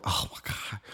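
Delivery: breathy whispered aside, overwhelmed; whispered oh my god. Retold or imitated. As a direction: mutter it under your breath in a whisper. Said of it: "I was like".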